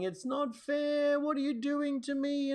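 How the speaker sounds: put-on high, whiny child's plea; a lightly voiced child near tears; imitating a crying child. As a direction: mimic a tearful kid protesting. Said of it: "he starts crying and saying".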